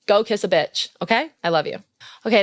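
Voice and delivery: normal talking voice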